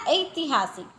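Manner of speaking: ऐतिहासिक starting with a clear ai; open ai sound. Shown as a demonstration. Teacher stresses ऐ, not ए, in ऐतिहासिक. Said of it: "ऐ बोलना है".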